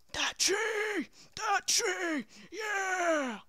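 high, drawn-out cheering shout; excited shouting. Pretend. Player picking that tree next, high excited shout. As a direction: shout it high like a rallying cry.

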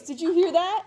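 ordinary delivery

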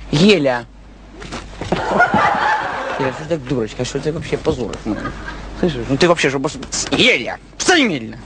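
deep scolding growl; low gruff voice. Acted.

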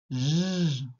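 J held as a long buzzing "zhhh"; isolated consonant sound. Shown as a demonstration. Teacher answering for first sound of genou, sustained J. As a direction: sound out J, not the letter's name. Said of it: "quels sont au début".

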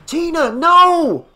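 high, drawn-out warning shout. A narrator's reading. Father shouting at daughter on the rickety dock, high shout. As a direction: shout to stop them, loud and high. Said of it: "he shouted".

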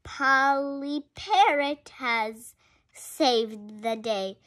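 ordinary delivery, nothing marked

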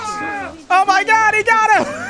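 high shocked oh my God; shocked yell. Real, not acted. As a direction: cry out as he eats him. Watches someone eaten.

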